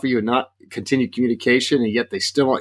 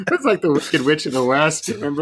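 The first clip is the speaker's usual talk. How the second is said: laughing; 4 semitones higher than usual. Real, not acted. Laughing at the hourglass image.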